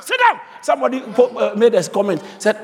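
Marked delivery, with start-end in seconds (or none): 0.0-0.4 high sharp shout; shouted command. Real, not acted. Preacher shouting at the listener, a sharp command. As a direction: bark it to sit down.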